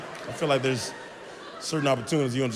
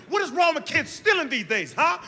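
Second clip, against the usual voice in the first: mock outrage; 13 semitones higher than usual.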